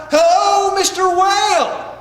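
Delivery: loud, high, drawn-out hail; calling out. Acted. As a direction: holler a stuttered hello across the water. Rabbit calls out to whale.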